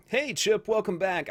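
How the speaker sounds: high, loud happy welcome; excited greeting. Real, not acted. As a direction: greet him with a big lift. Greeting chat viewer.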